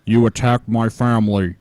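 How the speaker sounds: low, put-on aggrieved voice; deep-pitched caricature of a complaint; Brett Keane. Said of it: "made fun of how Brett Keane".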